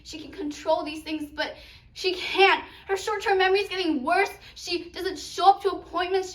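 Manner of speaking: loud, high, urgent mother; upset mother arguing. Acted. Mother tells her daughter how Popo is failing, loudly upset. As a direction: pile up the worries, then ease off at the end. Mother argues about Popo's memory.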